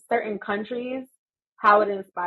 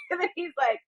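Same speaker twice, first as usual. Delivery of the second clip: usual speaking voice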